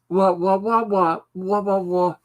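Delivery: muffled trombone-like wah wah; Peanuts teacher wah-wah. Pretend. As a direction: mimic the muted trombone teacher voice. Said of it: "doing the teacher's voice".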